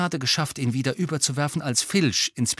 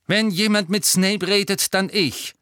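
firm, higher and louder, no argument; decisive; 9 semitones higher, 5 dB louder than usual. A narrator's reading. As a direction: lay down the law. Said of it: "meinte er entschieden".

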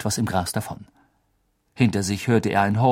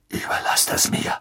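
hushed. Acted.

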